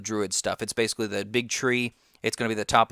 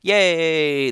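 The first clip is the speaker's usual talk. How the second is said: excited cheer; 5 semitones higher, 7 dB louder than usual. Real, not acted. Cheering at news of dungeons.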